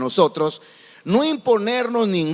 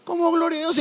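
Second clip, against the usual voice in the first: higher, indignant disbelief; incredulous; 9 semitones higher, faster than usual. Real, not acted. Preacher scolding a misplaced praise, incredulous. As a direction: ask it as if you can't believe your ears.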